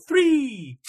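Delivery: high, loud excited yell; excited shout. Retold or imitated. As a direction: shout it like you're thrilled to be here. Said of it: "always really excited to be on".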